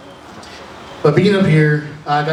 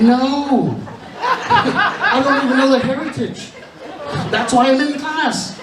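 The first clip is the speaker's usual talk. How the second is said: high-pitched comic protest; mock-indignant, drawn-out answer; exasperated admission; 8 semitones higher, slower than usual. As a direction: protest the obvious, half laughing. Acted protest to the teacher.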